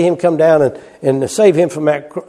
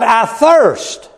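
crying out; 8 semitones higher than usual. Acted. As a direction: cry it out in anguish. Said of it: "He cried".

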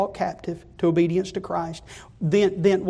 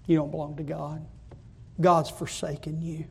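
devil whispering; 2 semitones lower, 7 dB quieter than usual. Retold or imitated. Preacher voicing the devil, soft taunting murmur. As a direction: murmur the doubt like a tempter. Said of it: "The devil whispers in my ear".

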